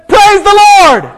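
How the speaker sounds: very high, loud acted yell; desperate shout. Rider stops dogs at the cliff edge, desperate shout. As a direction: yell it at the last possible second. Last-second shouted stop command.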